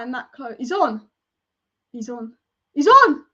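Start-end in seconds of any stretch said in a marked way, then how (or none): 1.9-2.3 muttered. Real, not acted. Fan checking the replay mutters to himself, quiet. Fan insisting goal is onside. 2.7-3.2 loud, higher triumphant call; triumphant shout. Real, not acted. Fan sure the goal stands shouts it out, triumphant.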